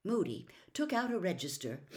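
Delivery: usual speaking voice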